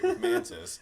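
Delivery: high, disgusted voice; mock disgust. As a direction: squeal it out in revulsion. Acting out Drax's disgust.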